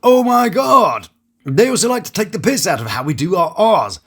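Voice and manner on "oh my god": loud, high, drawn-out mock American accent; hammed-up American, slow and high; French impression of an American. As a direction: stretch it out in a brash American accent. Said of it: "when they do an impression of American".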